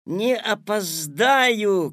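high, loud, stretched yell; boy calling back to his mother; drawn-out shouted reply; shouting back. A narrator's reading. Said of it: "крикнул Петя в ответ".

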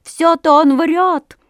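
high, loud, slow jeer; call out. A narrator's reading. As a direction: call up from the water mockingly. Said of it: "крикнул трубочисту из воды Ёрш Ершович".

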